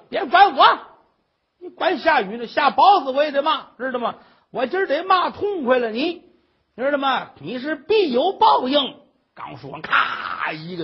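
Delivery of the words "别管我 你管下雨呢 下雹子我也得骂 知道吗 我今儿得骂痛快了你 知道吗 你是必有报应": high, loud hammed-up tirade; defiant cursing. Mother on the roof cursing despite the coming rain, furious. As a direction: rant on at full voice, refusing to be stopped.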